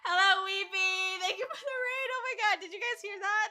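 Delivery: very high, loud squealing greeting; excited raid welcome. Real, not acted. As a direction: giddily welcome incoming guests with laughter.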